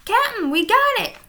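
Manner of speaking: high, loud pirate shout; pirate calling triumphantly to his captain. A narrator's reading. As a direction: yell it out in a squeaky pirate voice. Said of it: "shouted Pinky".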